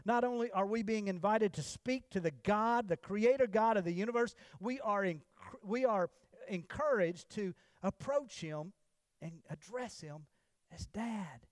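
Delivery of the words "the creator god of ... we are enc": ordinary talk, nothing marked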